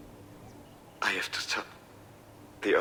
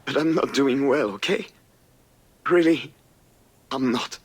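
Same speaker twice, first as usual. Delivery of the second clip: upset outburst; 6 semitones higher, 7 dB louder than usual. Acted. Son breaks down to parent.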